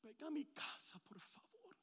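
"Ven a mi casa" susurrado, sin voz; súplica susurrada. Acted. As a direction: ruega "por favor" en un susurro. Whispered prayer plea. ("ven a mi casa" en susurro)

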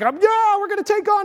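very high, excited whoop; hammed-up rallying yell; eager battle cry. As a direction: imitate a fired-up follower. Acting out Peter's eager battle cry.

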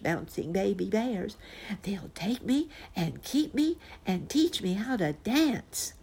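high, pleading little cub voice; determined bear cub. A narrator's reading. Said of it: "he looked very determined".

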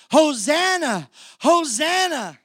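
high, loud joyful cry of Hosanna; crowd shouting. Retold or imitated. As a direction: shout it like the crowd waving palms. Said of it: "and they're shouting".

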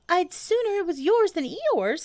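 very high, loud little animal; Piglet voice. A narrator's reading. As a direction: squeak it as a small, earnest creature. Piglet answers Pooh.

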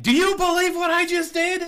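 high squeaky astonished voice; amazed disbelief. Retold or imitated. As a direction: squeal it like you can't believe it. Voicing player's amazed face.

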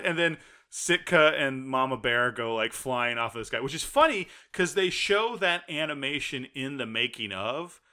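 normal talking voice

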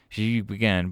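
ordinary delivery

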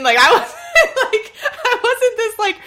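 high, giggling words; laughing. Real, not acted. Daughter laughs at her younger self while telling her mother the story. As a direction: giggle through it.

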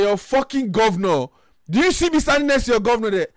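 high, loud outraged rant acted out; retold shouting. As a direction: yell it as if pulling rank on police. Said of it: "So I started shouting".

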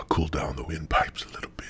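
whispered with sore throat; sore throat hush. Real, not acted. Soothing his throat after smoking, whispered. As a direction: barely voice it, throat raw. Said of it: "eat a little piece of ice".

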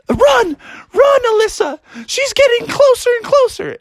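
high-pitched cartoon panic; panicked frog. Froggy urges Alyssa to flee the goblin, high panicked shout. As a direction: cry out urgently while fleeing. Froggy flees, calls sister.

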